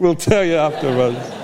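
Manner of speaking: repeating it with a chuckle; laughing. Real, not acted. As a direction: laugh through the words.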